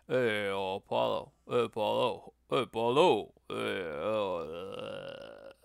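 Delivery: lightly voiced mumbling boxer calling out; slurry, low-effort fighter's call; Rocky impression. Streamer repeating his Rocky bit for chat, lightly mumbled. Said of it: "that's my Rocky impression".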